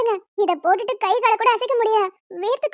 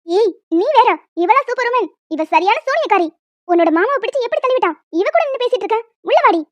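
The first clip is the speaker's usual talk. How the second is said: angry mother. Acted. Mother scolding the child, ordering her inside.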